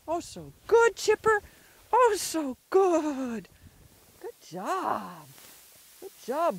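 loud, high praise for a dog; lavish it on, stretching the words; gushing praise. Real, not acted. Owner praising dog for fetching, gushing praise.

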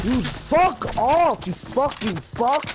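high slow hammed-up yell; mock rage. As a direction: yell it in fake fury.

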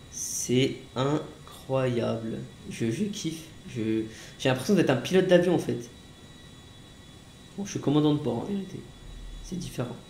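ordinary talk, nothing marked

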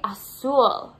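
azul said slowly and clearly, ah-SOOL; Spanish word azul. Shown as a demonstration. Teacher modelling azul slowly for young learners. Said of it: "how to say blue in Spanish".

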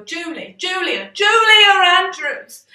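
rising panic into a loud cry; panicked blurt. Retold or imitated. Comic caught lying blurts out a fake girlfriend's name, panicked shout. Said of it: "I just panic and I go".

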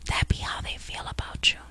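conspiratorial whisper to viewers; whispered aside. Real, not acted. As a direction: whisper it like a secret. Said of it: "aside to viewers".

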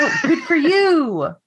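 slow, high, laughing echo; mock praise. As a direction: draw out fake congratulations in a high voice.

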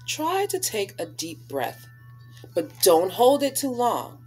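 ordinary delivery

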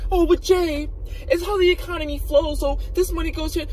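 high mocking voice of an economy explainer; mocking know-it-all. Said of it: "I don't wanna hear".

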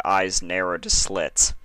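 plain reading voice

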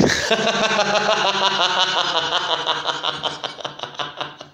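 long giggle, high then falling; real laughing fit. Real, not acted. Cracking up at saying the wrong word, a long helpless laugh. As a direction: crack up at your own slip, laughter tailing off.

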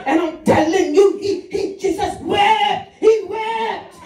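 high, stretched-out hollering preacher, dragging out each word; preaching a mock sermon, milking every word; drawn-out preacher. AME preacher dragging out Jesus wept, high and slow. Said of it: "and he was saying".

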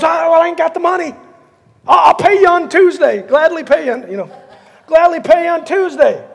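high, fast, nervous begging; pleading debtor. Acted. Debtor to the servant, nervously pleading. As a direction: scramble to promise payment.

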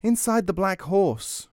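put-on very high small boy voice; little boy crying out. A narrator's reading. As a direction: pipe up from somewhere hidden. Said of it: "cried Cecchino".

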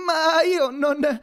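high, loud, drawn-out protest; panicked yell, stretched long; crying out. A narrator's reading. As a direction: wail the words in helpless alarm. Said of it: "gridò di nuovo".

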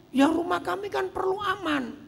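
high, whiny mock pastor excuse. Preacher mimics pastors excusing their security guards, high mock whine. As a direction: parody a pastor justifying his guards. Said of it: "Alasannya macam-macam".

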